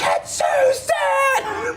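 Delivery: very high, drawn-out mock scream; imitated screaming. Passenger losing it over her name, imitated scream. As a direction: stretch the name into a shriek. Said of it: "Like, screaming".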